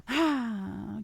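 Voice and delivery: audible sigh; real sigh. Real, not acted. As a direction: sigh before getting back to the topic. Sighs before returning to topic.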